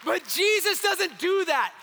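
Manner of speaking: loud, impassioned sermon delivery; full-force preaching. Acted. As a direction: preach it hard to the congregation.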